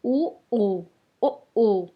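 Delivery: rounded-lip उ and ओ said one by one; teacher sounding उ ओ; lip vowel demo. Shown as a demonstration. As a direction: round the lips for उ, ओ. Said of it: "ये दो अक्षर और हैं".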